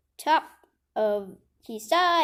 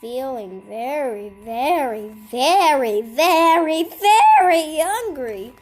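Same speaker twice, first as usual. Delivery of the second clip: each very louder until a near shout on hungry; building to a shout; 3 semitones higher, 11 dB louder than usual. A narrator's reading. Reading to a child, growing louder with each very. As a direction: ramp up the verys into a big booming hungry.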